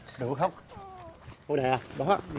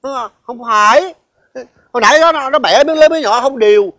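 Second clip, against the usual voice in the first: flustered excuse; 4 semitones higher, 6 dB louder than usual. Acted. Uncle defends himself loudly to grandpa.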